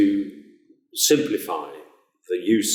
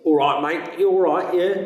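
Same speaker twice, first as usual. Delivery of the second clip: higher, louder cheeky London greeting; London accent; 9 semitones higher, 4 dB louder than usual. Shown as a demonstration. Teacher showing how Londoners greet a mate, London accent.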